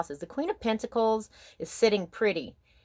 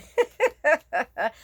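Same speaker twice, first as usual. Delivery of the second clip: quick high laugh; short bright laugh; 10 semitones higher, 7 dB louder than usual. Real, not acted. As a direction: laugh out loud briefly. Laughs mid tarot reading.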